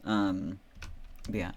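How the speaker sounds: normal talking voice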